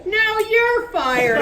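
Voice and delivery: high, loud, slow shout back; match his yell; shouting back, decibel for decibel; matching his volume. Acted. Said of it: "matching him decibel for decibel".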